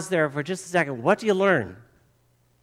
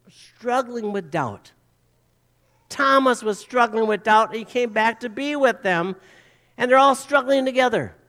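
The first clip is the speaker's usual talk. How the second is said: ordinary talk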